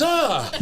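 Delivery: high, loud yelp of alarm; hammed-up startled cry; startled yell. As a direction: imitate someone jolted out of sleep. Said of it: "like that".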